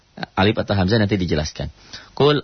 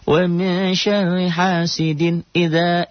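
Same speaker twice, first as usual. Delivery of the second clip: high, slow chanted recitation; Quran recitation demo; 7 semitones higher, slower than usual. Shown as a demonstration. Teacher chanting the verse for students, slow and high. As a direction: draw out the Arabic like a reciting teacher. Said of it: "idzanya matobi'i".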